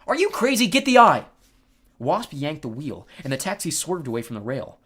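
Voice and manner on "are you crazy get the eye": high, loud urgent retort; incredulous shout. A narrator's reading. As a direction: shout back in disbelief. Shouted retort in speeding taxi.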